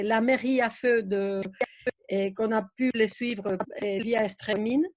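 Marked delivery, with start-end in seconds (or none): none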